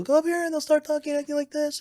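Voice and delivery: high pinched voice, held way up; pinched larynx. Shown as a demonstration. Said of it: "pinch their larynx".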